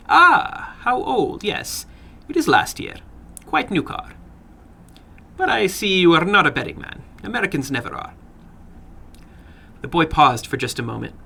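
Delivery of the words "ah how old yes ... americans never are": a lightly voiced little man, higher in places; little man calmly explaining the chopping, a lightly voiced odd figure; odd little foreign man. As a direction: calmly explain how you'd chop the finger off.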